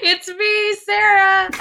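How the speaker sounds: high squeaky playful voice; high silly voice. Pretend. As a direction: pitch way up for a goofy hello. Host introduces herself playfully.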